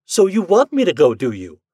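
high, loud start dropping at the tag question; demanding. Acted. Wife challenges husband who agrees she should leave, demanding. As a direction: demand it, affronted. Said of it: "she demanded".

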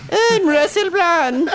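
announcer flourish. Acted. Host announcing a celebrity guest, high and slow. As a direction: announce the name like a game show host.

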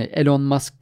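Elon said "E-lon", with a full o; spelling pronunciation. Shown as a demonstration. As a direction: say Elon as written in Turkish. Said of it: "telaffuzlara çok takılmayalım".

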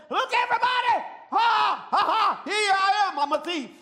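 loud, high, boastful thief yelling and laughing; mocking bold thief bellowing his arrival; brash thief shouting. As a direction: shout it out like a show-off burglar. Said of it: "jumps in and says".

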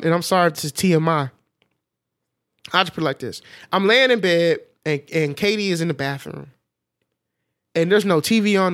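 usual speaking voice

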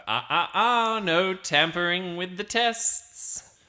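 high, slow sing-song reprimand; scolding king. Acted. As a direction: chide a cheat from on high. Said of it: "You hear the king's voice go".

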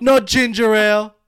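put-on loud, high, stretched-out Jamaican accent. Listing banned snacks from the clip, loud Jamaican accent. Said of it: "Did you see the Jamaican one".

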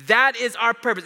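preacher hammering the key line; full-force preaching. Acted. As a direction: proclaim it like a rallying cry. Preaching climax.

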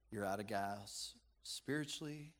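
ordinary talk

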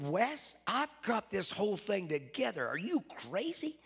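voicing the partner's disbelief, half-shouting; incredulous outburst. Business partner yells at Grandpa.

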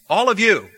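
"All of you" punched high and loud; emphatic plural YOU. Retold or imitated. Preacher paraphrasing Jesus to all the disciples, emphatic "All of you". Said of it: "It's also plural".

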